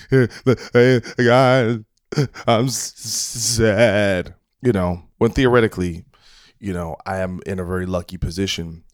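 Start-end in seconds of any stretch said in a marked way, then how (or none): 0.1-4.3 mock whiny sad greeting.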